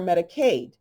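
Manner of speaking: normal talking voice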